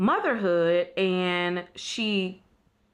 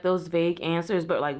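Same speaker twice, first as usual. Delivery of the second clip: ordinary talk, nothing marked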